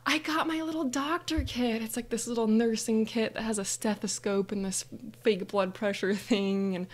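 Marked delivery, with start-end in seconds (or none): 0.1-1.8 high-pitched acted gift delight; all excited. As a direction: announce the present like a thrilled child. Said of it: "all excited".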